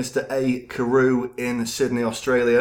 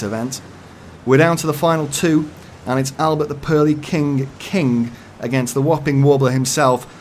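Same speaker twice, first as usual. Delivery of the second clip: loud, excited live sports commentary; hammed-up ringside commentator; 5 semitones higher, 8 dB louder than usual. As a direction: call it like a big live event.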